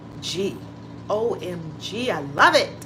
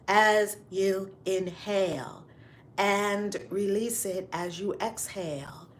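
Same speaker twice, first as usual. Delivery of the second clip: guided breathing; 4 dB quieter than usual. Acted. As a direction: lead them calmly through each breath. Leads a breathing exercise.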